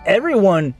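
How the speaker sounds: normal talking voice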